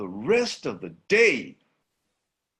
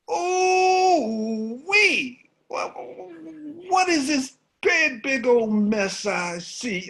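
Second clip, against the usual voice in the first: much higher, loud crowing monkey cry; monkey mocking the beaten lion; gloating monkey; 5 dB louder than usual. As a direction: crow with mock pity from the tree.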